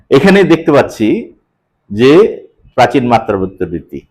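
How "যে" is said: ordinary delivery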